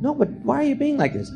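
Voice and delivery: high, pleading stammer; mock Jimmy Stewart whine, high-pitched; whiny Jimmy Stewart. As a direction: plead like a hurt old-time star. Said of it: "And he goes".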